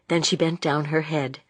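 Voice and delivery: ordinary delivery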